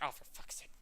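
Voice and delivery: whispered, exasperated curse. Acted. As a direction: whisper it in frustration. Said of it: "And he's like".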